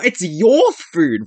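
slow, with "your" leaned on; "your" stressed in slow disbelief. Real, not acted. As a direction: correct them by drawing out "your". Corrects our to your.

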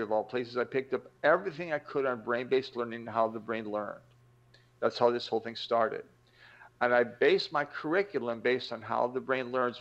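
ordinary talk

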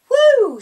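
high, loud surprised whoop; teacher reacting to the note; surprised woo. A narrator's reading. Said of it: "she said".

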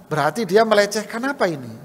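ordinary talk, nothing marked

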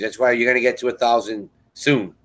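normal talking voice

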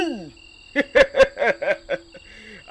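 rising gleeful villain cackle; wolf's delighted predatory laugh; evil laugh. Acted. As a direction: laugh high at the cornered prey. Villain gloats over trembling prey.

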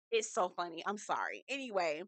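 ordinary talk

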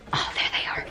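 soft whisper to herself. Real, not acted. Finding the shoes in a box, whispered to herself. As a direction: breathe it out, relieved.

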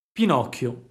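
plain reading voice

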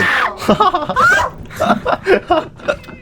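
high shrieking burst of laughter. Real, not acted. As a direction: let out a wild screaming laugh.

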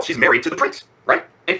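ordinary delivery, nothing marked

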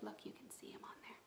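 quick hushed whisper to the class; whispered aside. Real, not acted. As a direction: lean in and whisper it fast. Aside pointing at the picture.

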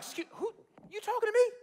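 high-pitched, mock bewildered question; incredulous. Gideon answering the one who hailed him, incredulous. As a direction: squeak it out in disbelief. Acts Gideon disbelieving the hailing.

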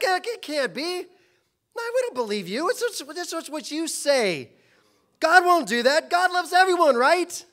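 high, loud scoffing crowd voice; mocking disbelief. The crowd scoffing at Noah's flood warning, loud mocking disbelief. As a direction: laugh him off, you think he's a fool.

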